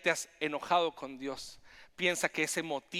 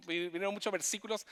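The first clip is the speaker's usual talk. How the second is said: tumbling out fast, words ahead of thought; rushing; 4 semitones higher, 4 dB quieter, faster than usual. Real, not acted. Preacher recounting verses that flooded his mind, fast. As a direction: hurry through it, excited. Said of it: "estoy hablando más rápido de lo que pienso".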